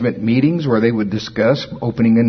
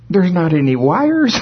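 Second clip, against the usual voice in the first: higher, baffled mother's voice, lightly played; imitating elderly mother; 8 semitones higher than usual. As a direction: pitch up, puzzled objection from mom. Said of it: "And she'll go".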